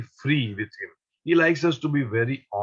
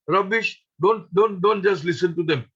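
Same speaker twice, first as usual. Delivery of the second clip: insistent; 7 semitones higher, 3 dB louder than usual. Retold or imitated. Baba insists she ignore women.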